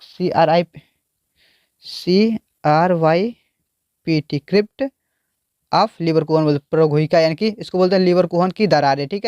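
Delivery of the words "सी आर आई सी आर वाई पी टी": slow, letter by letter spelling; spelled out letter by letter. Shown as a demonstration. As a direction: spell CRYPT for the board. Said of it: "इसको लिख देते हैं".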